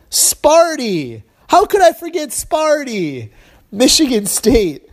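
high-pitched mock astonishment, drawn out at the end; exaggerated sarcastic exclamation. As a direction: fake a big sudden realization, voice shooting up. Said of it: "I am being sarcastic".

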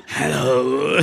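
rattling breathy hello; death rattle. Shown as a demonstration. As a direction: croak it like a dementor. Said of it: "Röchel du mal lieber auf Englisch".

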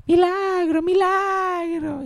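high drawn-out call for grandma; abuela llamando. Acted. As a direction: imitate calling out a name, very high and stretched. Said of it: "Así que es como que". (llamado agudo y alargado, imitado)

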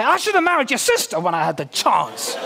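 cheeky joke line. Pretend. Pastor jokingly quoting a line to his wife, half-laughing. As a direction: tease the wife, giggling through it. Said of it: "That's a bad thing to say".

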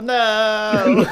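shrill shocked "no!" as a British official; hammed-up outraged shriek; mock outraged official.

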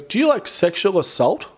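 high, blurting imitation of the collector; lightly mocking the charity guy; mock blunt question. Charity guy blurting at shoppers, mock blunt question. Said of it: "And he goes".

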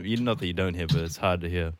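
normal talking voice

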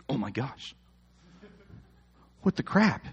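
exasperated dad. Retold or imitated. Dad fed up with bickering.

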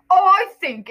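loud, booming pompous uncle; Uncle Vernon voice. Shown as a demonstration. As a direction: announce it as a self-important uncle. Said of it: "this is my Vernon voice".

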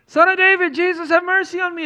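high, loud pleading cry for mercy; desperate beggar cry. Acted. Blind Bartimaeus begging Jesus from the roadside, high pleading cry. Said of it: "you're Bartimaeus ... you're like".

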